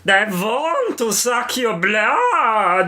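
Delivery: slow, high put-on vampire voice. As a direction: drawl it like a cartoon vampire. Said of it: "have you got a good vampire voice?".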